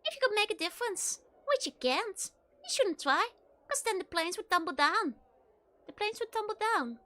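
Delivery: Scottish brogue, rising and insistent; Scottish accent. Acted. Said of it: "like some Scottish person".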